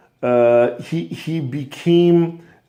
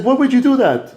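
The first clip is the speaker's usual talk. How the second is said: high, louder disbelief; baffled father; 9 semitones higher, 3 dB louder, faster than usual. Acted. Father asking his son about the coat, baffled. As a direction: demand an answer, bewildered. Father reacts to son's plan.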